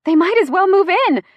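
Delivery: high, loud, giddy squeal; wheezed between chuckles. A narrator's reading. Gemma to Chase about reporters, hysterical high wheeze. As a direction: squeeze it out high, near hysterics. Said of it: "I wheeze out between chuckles".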